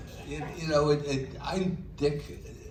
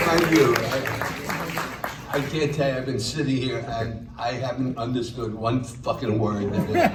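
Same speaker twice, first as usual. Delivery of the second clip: loud; 2 semitones higher, 7 dB louder than usual. Real, not acted. Comic booms opening rant.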